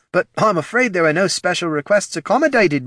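high, put-on official's apology; ghoul's remark. Ted refuses the young shade's wish, high voice. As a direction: let her down in a high voice.

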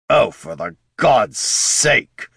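slow, loud, high exasperated cry; annoyed outburst. Acted. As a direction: throw up your hands in disgust.

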